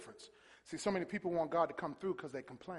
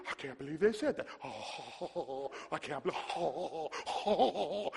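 mock gasping hurt; slower than usual. Believer stung by a negative word, mock gasping hurt. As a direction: act winded by the insult, heaving big oh's. Acts out a hurt person stopping.

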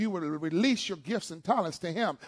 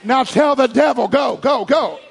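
commanding shout; 4 semitones higher, 9 dB louder than usual. Acted. Calling out to devil.

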